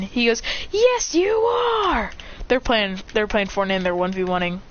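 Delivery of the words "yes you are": very drawn-out high retort; high, insistent shout back; quarrelling yell. Acted. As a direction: stretch it like a squabbling kid. Shouting match over video game.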